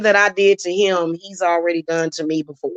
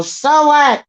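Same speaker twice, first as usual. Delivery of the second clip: high, louder dismissive so what; mock dismissive; 8 semitones higher, 4 dB louder than usual. Imitating the man brushing off the family, mock dismissive. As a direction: shrug it off in a high mocking tone. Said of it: "and then you go".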